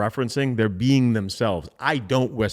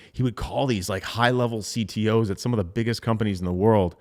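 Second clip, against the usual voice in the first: normal talking voice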